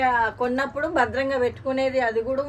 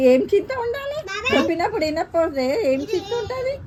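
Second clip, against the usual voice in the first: amused; 6 semitones higher, 4 dB louder than usual. Real, not acted. Mother laughing off son's worry.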